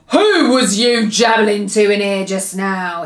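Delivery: loud, booming giant voice; storybook giant's demanding boom. A narrator's reading. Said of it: "he boomed".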